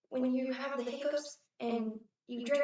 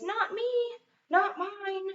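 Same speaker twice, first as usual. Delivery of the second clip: put-on high, louder childish denial; class chorus; 10 semitones higher, 6 dB louder, much slower than usual. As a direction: chirp it like a room of kids all denying. Whole class denies the sweater.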